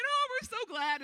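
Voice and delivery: overflowing happy crowd; high, loud, joyful gush; crying for joy. Retold or imitated. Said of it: "They're crying".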